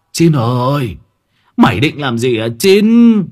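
put-on old man's loud reproving call; lớn tiếng quát. A narrator's reading. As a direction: call out sharply, stretching the final name. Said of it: "một ông già lớn tiếng quát". (quát lớn, kéo dài giọng)

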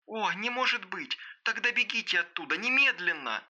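high, alarmed, distant voice; horrified. A narrator's reading. Девушка велит Аманде бежать из дома, horrified. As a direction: urge escape in high-pitched horror. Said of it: "в ужасе воскликнула девушка".

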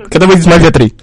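loud shouted question; shouting. Real, not acted. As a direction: shout it into the phone. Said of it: "Всё равно, блядь, не слышу". (громко, кричит в трубку)

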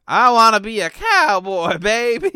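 loud and drawn-out; excited cowboy shout. Pretend. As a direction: belt it out slowly. Excited about cowboy armor.

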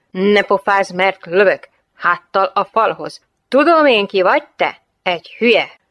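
loud, hostile police command; shouted threat. Acted. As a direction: cut off the backtalk with loud contempt. Armed officer threatens the lieutenant. (hangos, fenyegető, lekezelő parancs)

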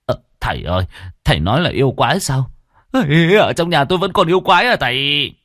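alarmed, halting start climbing high; stammering. A narrator's reading. Lão Vinh asks the exorcist about a monster at home, stammering in alarm. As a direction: blurt the question in frightened disbelief. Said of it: "Lão lắp bắp".

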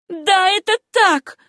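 high, loud, slow defiant cry; shouted. A narrator's reading. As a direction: fling it back at him. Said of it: "крикнула Сюзанна". (высокий громкий вызывающий крик)

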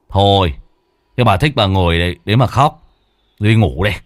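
dismissive. Acted. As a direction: shrug her off loudly.

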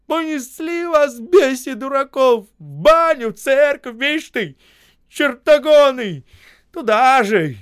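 furious high-pitched tirade; angry high voice. A narrator's reading. Осип cursing the crowd, angry high voice. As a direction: scold them loudly in a high, wrathful voice. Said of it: "злым высоким голосом заговорил".

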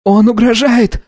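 put-on high-pitched alarmed boy; boy's cry. A narrator's reading. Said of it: "закричал мальчик".

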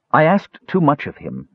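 a touch of hurt held in check; hurt, trying for evenness. A narrator's reading. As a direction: try to say it evenly through hurt. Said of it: "she tried to say evenly".

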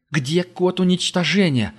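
slow, high demanding question; hard demand. A narrator's reading. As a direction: press him for the code. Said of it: "спросила Герда".

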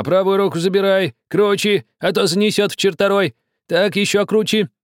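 high, loud commands; commanding. A narrator's reading. Old man commanding the pilot, loud. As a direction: bark orders at the helm. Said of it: "начал командовать".

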